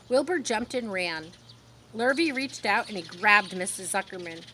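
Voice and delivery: ordinary delivery, nothing marked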